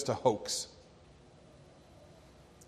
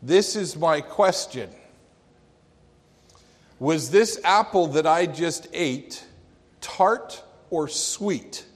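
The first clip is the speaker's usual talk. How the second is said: louder, slowing right down at the end; old preacher's question; 4 semitones higher, 5 dB louder than usual. Retold or imitated. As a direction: draw out tart or sweet.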